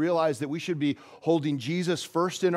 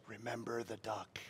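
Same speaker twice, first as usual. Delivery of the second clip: hushed, softer sly threat; menacing whisper; 5 semitones lower, 19 dB quieter than usual. Retold or imitated. Sally leaning over to threaten Tommy, hushed whisper. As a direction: lean in and breathe it like blackmail.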